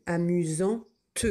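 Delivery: amusante said slowly, the final T sounded clearly; slow spelling voice. Shown as a demonstration. Teacher to pupils, amusante drawn out slowly. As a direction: stretch amusante so the T rings out. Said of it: "Au féminin, on dit".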